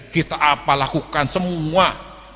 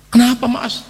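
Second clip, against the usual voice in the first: wife asking; 10 semitones higher, 4 dB louder than usual. Retold or imitated. Wife voiced waking up.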